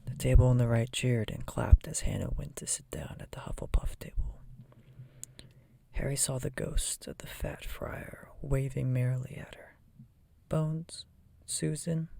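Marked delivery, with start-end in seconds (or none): none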